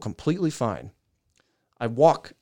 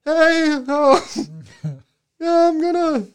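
high, wobbly, nervous voice; shaky voice; 7 dB louder, slower than usual. Retold or imitated. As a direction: fake bravery with a trembling voice. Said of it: "I put on my bravest, shaky voice".

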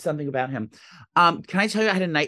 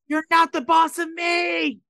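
high, drawn-out shout; screamed in sleep; 12 semitones higher, much slower than usual. Retold or imitated. Woman retelling her night terror to co-host, screaming. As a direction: re-enact a sleep-screamed outburst at full voice. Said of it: "I screamed that".

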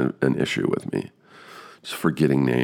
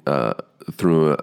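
ordinary talk, nothing marked

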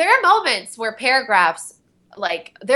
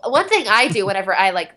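ordinary talk

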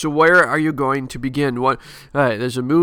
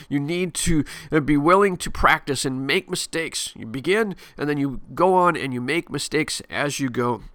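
ordinary talk, nothing marked

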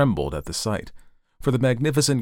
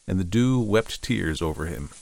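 usual speaking voice

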